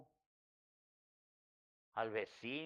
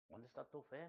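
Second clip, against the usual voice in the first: gentle rebuke from God; 11 dB quieter than usual. Retold or imitated. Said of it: "me dijo el Señor".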